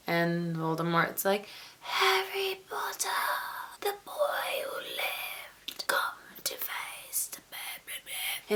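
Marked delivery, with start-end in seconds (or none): 1.8-8.5 slow, whispery villain voice; badly faked sinister whisper, drawn out; Voldemort. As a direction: whisper it slowly like a menacing dark lord. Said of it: "In his, like, whispery voice".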